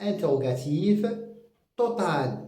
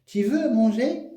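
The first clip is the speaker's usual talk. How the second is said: "Tu veux manger?" repeated higher, rising on "veux"; teacher demo of "Tu veux manger?" as a question; question intonation; 6 semitones higher than usual. Shown as a demonstration. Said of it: "à la fin de la phrase".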